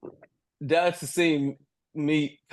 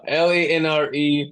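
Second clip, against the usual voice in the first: usual speaking voice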